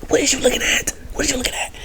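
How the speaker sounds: high, soft, whispery mock challenge; hushed retold question. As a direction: imitate a kid quietly questioning a staring parent. Kids teasing staring dad, retold.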